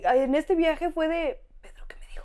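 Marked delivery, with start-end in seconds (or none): 1.6-2.2 voiceless aside, much softer; confused whisper. Retold or imitated. Needing a translation on the trip, confused whisper. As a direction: whisper it secretly to a companion.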